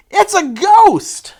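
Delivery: high, slow frightened cry of ghost; mock terrified shout, high and drawn out. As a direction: shriek it like you've seen a ghost. Disciples cry out in fear.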